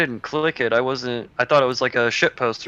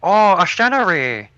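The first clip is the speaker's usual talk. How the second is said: slow, higher mock-Chinese accent; 5 semitones higher, slower than usual. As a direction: draw out "Stanley" as Shtan-a-ree in a put-on Chinese accent. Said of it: "for the Chinese, you gotta go".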